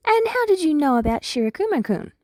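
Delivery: high, curious probing voice; Nedzu's voice. Acted. Nedzu presses Big Eri, high and curious. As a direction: lift the pitch, the principal prodding.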